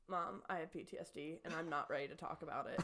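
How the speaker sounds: low, soft deadpan; retold subdued confession. Daughter telling mom she can't talk yet, subdued deadpan. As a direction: say it low, as if too traumatised to discuss it. Retells deadpan answer to her mother.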